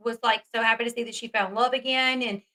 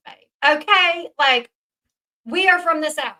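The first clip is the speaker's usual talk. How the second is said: sarcastic; 5 semitones higher, 6 dB louder than usual. Real, not acted. Sarcastic jab at Lindsay.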